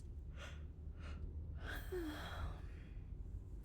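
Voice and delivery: long, soft exhale while drifting off; sleepy sigh. Acted. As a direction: sigh yourself toward sleep.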